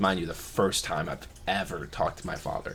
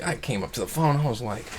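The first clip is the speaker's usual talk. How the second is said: ordinary delivery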